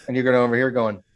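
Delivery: usual speaking voice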